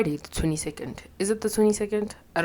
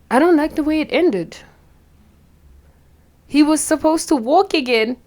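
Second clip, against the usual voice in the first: much higher and louder, voice crying; tearful whine; 11 semitones higher, 8 dB louder than usual. Real, not acted. Upset viewer complaining about the ending, tearful wail. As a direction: wail it out through tears.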